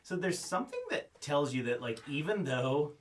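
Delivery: usual speaking voice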